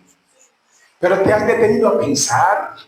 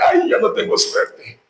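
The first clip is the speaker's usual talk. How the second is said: mock tearful whine; 10 semitones higher than usual. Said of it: "Hasta con lágrimas".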